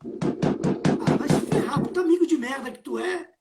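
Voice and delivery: fast, disgusted insult; angry. Acted. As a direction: spit out the insult at him. Friend insults the one who bailed.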